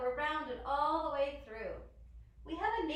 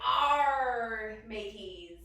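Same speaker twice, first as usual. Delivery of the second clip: loud long arrr, then dropping lower; pirate; 3 semitones lower, 8 dB louder, much slower than usual. Pretend. Teacher leading children in plank, loud pirate call. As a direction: hail the mateys like a pirate. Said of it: "Pirates also like to say".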